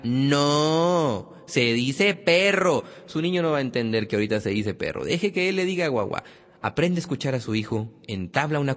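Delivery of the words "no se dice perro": slow, emphatic mock correction; correcting parent. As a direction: draw out each word to correct a child. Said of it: "no le diga".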